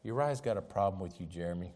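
a hint of the devil sowing suspicion; devil's whispering voice. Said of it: "And he says".

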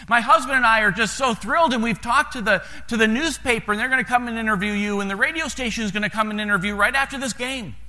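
mayor's wife gushing, high and bright with excitement; thrilled mayor's wife. Retold or imitated. Mayor's wife greeting the pastor in the stands, excitedly gushing. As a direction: pour out the good news to the pastor, delighted. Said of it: "and she said".

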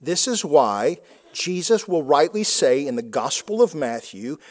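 normal talking voice

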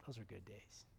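put-on very soft, low old man's reminiscence; hushed, low, moved old-timer; wistful old man. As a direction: sigh it out like a tearful old man. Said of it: "all wrinkled up as an old man".

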